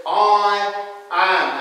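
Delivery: weighty stress on both words, I AM; emphatic I AM. Retold or imitated. Preacher quoting Jesus to the congregation, emphatic I AM. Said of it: "That's very emphatic".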